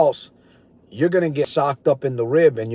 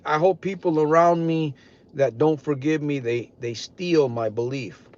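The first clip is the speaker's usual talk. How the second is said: ordinary talk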